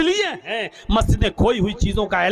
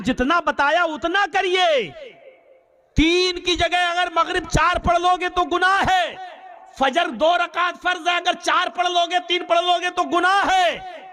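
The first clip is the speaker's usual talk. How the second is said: fiery preaching; 6 semitones higher than usual. Acted. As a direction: thunder the warning about sin. Preaching at full force. (ऊँची आवाज़ में ज़ोरदार तक़रीर)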